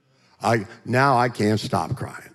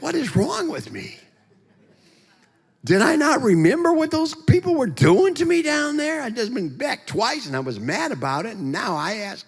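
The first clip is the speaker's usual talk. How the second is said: very high, squeaky comic rant; exasperated squeal at your own stupidity; incredulous self-scolding; 14 semitones higher than usual. Acted. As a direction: berate yourself in pitched-up disbelief. Berating himself for asking.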